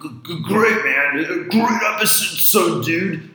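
high, stammering surfer-dude take on Tom; mock co-host hype voice; imitating Tom. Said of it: "Tom said it was".

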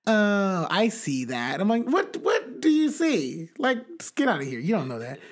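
high, loud, fed-up comeback to a smug know-it-all; exasperated retort. Retold or imitated. Telling a cohost about astrology fans, exasperated mock retort. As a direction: scoff back, annoyed and incredulous.

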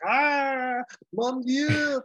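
high, loud mock French death cry; French villain cry. Villain consumed by lava, a hammed French cry. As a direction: ham up the villain's dying French wail. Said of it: "He's like".

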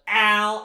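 loud, high "ow"; mock cry of pain. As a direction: yelp as if hit in the back. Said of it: "and he goes".

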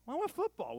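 high indignant demand, half joking; mock whiny demand. Tourist insisting to hotel staff, high and whiny. Mock complaint about soccer balls.

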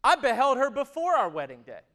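loud, driving preacher voice; full-force preaching. Acted. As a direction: preach it out to the whole room.

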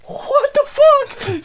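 very high, stretched-out incredulous squeal; hammed-up high-pitched bewilderment; retold disbelief. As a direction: squeal it slowly in mock disbelief. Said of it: "I'm looking at him, and I'm like".

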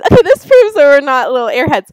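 ordinary talk, nothing marked

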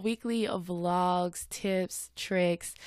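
weekly vlogs drawn out very slowly; weekly vlogs spelled out at half speed; slow deliberate correction. Shown as a demonstration. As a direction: settle the wording of weekly vlogs, slowly and clearly. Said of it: "I was gonna say week in a life".

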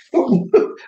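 high breathless laugh breaking out mid-story; burst of laughter. Real, not acted. Laughing to the interviewer at his uncle's bluff.

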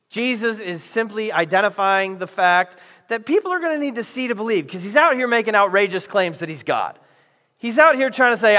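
ordinary delivery

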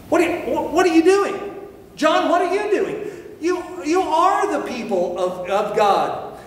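who does this John think he is; incredulous challenge. Retold or imitated. Preacher voicing religious establishment confronting John, incredulous and high.

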